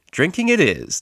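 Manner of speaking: high, loud, triumphant call; declared. Acted. DJ picks the outing, loud and triumphant. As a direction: announce it like a victory. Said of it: "declared the D J".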